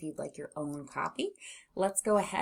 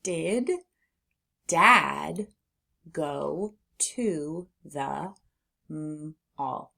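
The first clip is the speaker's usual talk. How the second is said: slow word-by-word reading with long pauses; sounding out; much slower than usual. Shown as a demonstration. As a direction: read each word alone, sounding out "mall". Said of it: "Let's read".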